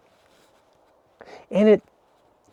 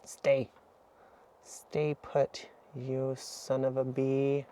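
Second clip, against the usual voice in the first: hushed command; 11 dB quieter, slower than usual. Real, not acted. Telling the dog to stay.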